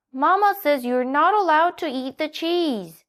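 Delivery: put-on child donkey wheedling; Little Donkey. A narrator's reading. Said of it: "said Little Donkey".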